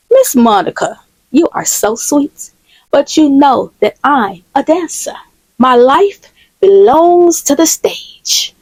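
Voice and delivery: drawn-out, lilting dramatic flourish; grand, slow, sing-songy receptionist; theatrical receptionist. Acted. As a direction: play the self-declared stage star, stretching the words.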